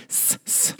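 breathy hissed S; hissing S. Shown as a demonstration. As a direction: hiss the S like a snake. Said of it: "make you go crazy with the S's".

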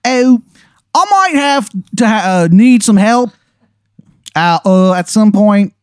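very high, badly faked Maryland accent; a hammed-up Maryland accent that can't land; terrible Maryland accent. Game master voicing Shithead Steve to Aaron, awful Maryland accent. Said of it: "It's a horrible".

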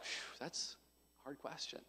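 exhale, then barely voice it; very soft, low, as if thinking to oneself; hushed stunned reaction. Retold or imitated. Retold stunned reaction to hard question.

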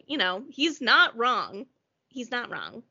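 normal talking voice

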